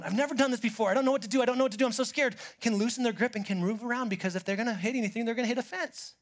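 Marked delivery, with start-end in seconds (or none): 0.0-2.4 higher, louder mock panic; a touch of hammed-up fear; scared. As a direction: act out a frightened beginner.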